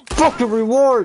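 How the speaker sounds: high, loud, fast demand; indignant yell, fast and loud; angry outburst. Real, not acted. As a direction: snap the demand out suddenly.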